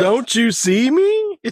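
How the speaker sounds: high, slow dopey deer voice; a deer frozen in headlights, slow and high. Retold or imitated. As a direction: play a dumb deer staring at cars. Voicing the deer.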